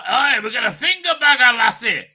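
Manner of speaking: loud, eager Scottish sidekick; mock Scottish accent. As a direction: shout it in a joking Scottish accent. Voicing Jamie's eager Scottish reply.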